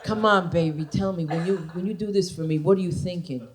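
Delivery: low, soft mock-male voice; coaxing man. Man asking his partner what she's thinking, low coaxing impression.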